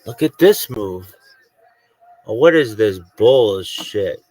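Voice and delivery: slow, loud scoff at the game; loud disbelief. Real, not acted. Gamer scoffing at a bad move, slow and loud. Gaming frustration outburst.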